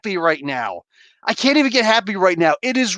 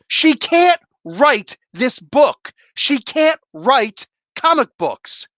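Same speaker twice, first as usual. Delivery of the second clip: slow, deliberate, each word hammered; emphatic verdict; 3 semitones higher, slower than usual. Real, not acted. Angry reviewer slowly pronounces his verdict on the writer.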